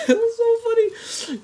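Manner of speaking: high, squealing through laughter; helpless laugh, voice shooting up; real laughing. Real, not acted. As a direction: crack up while talking. Cracks up at own prank story.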